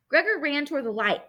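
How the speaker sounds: ordinary delivery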